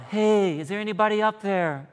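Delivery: joking preacher voices the climber crying out. Retold or imitated. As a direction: call up the cliff like a stranded climber. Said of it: "he cries out".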